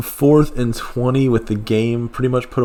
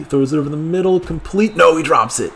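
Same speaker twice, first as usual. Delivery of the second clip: tense excitement; 9 semitones higher, 4 dB louder than usual. Real, not acted. Tense live pass play.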